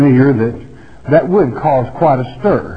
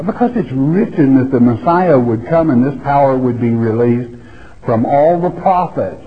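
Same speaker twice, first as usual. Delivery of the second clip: ordinary talk, nothing marked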